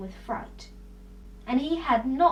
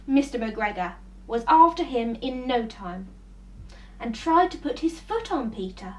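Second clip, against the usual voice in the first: ordinary delivery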